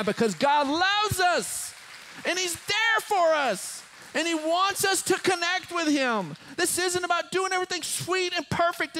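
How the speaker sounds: high, loud, impassioned sermon; full-force preaching. Real, not acted. Pastor telling church God loves us, full-force preaching. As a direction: drive it home to the congregation, pitch way up.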